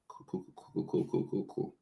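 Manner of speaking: breathless whispered repeats; calm yourself under your breath; whispered mantra. Retold or imitated. Whispered self-soothing.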